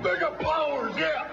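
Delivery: high, shouted promo yell. Real, not acted. Macho Man promo rant.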